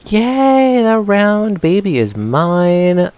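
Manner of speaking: high, slow, gleeful cheer; delighted cheer. Real, not acted. Player catching a Sandshrew, gleeful high cheer. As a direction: crow it out like you won.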